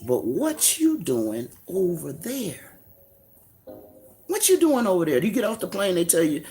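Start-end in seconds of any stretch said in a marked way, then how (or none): 0.0-2.8 drawn out, softer question; slow, dropped question. Real, not acted. Repeated question, first take. 4.3-5.2 higher, pointed repeat of the question; higher pressing question. Real, not acted. Preacher pressing the same question at his listeners, higher.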